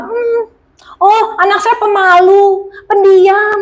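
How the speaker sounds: high, slow apologetic parent; excusing parent. Retold or imitated. A parent excuses a shy child to guests, high and slow.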